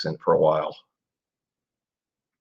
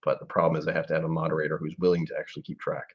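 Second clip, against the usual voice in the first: usual speaking voice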